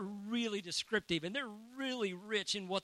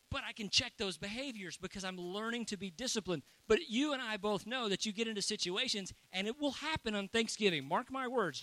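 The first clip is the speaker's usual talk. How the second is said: normal talking voice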